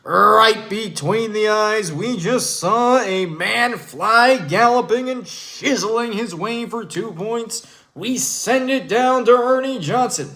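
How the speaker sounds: Kevin Harlan impression. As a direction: call it like a big highlight. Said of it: "And let's go to my man, Kevin Harlan".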